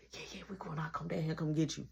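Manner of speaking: hushed whisper; whispering to brother about sneaking out. Retold or imitated. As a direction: whisper the plan.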